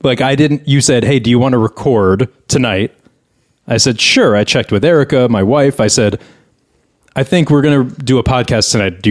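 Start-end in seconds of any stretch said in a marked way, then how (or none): none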